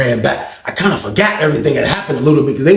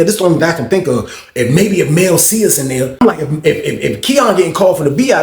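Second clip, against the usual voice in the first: ordinary delivery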